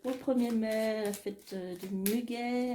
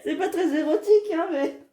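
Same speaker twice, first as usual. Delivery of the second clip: laughing; 9 semitones higher, 12 dB louder than usual. Real, not acted. Laughs at zombie love card.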